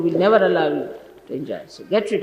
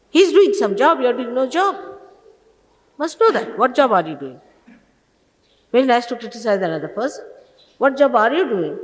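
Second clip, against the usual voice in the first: loud, higher-pitched telling-off; real scolding; 4 semitones higher, 4 dB louder than usual. Real, not acted. Teacher defending an organizer against critics, scolding. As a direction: take them to task, voice raised.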